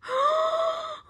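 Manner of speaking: very high gasp of longing; delighted gasp. A narrator's reading. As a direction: gasp at a mountain of honey. Norman sees the honey.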